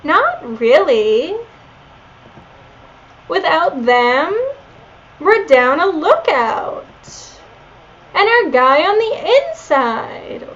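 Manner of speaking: put-on high, slow Valley Girl uptalk; drawn-out Valley Girl lilt. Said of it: "I did a Valley Girl voice for Leon".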